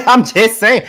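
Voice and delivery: high, loud laugh through the words. Real, not acted. Defending how they sound against Atlanta people, laughing. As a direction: laugh while protesting.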